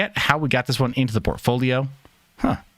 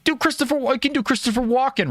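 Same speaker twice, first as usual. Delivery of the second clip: much higher mock fan voice; pestering fans; 12 semitones higher than usual. Streamer mimicking fans asking every time, high pestering voice. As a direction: imitate fans nagging for a party trick. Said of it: "It's like".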